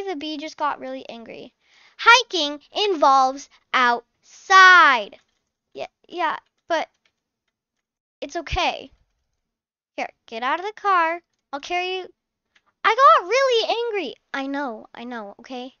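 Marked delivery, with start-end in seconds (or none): 2.0-5.2 loud, slow, dragged-out complaint; sulky child voice. Acted. Emily protesting the hike, a slow loud whine. 12.8-14.1 put-on high and louder pouting kid; sulky child voice. Emily explaining the bee, a high pouty whine.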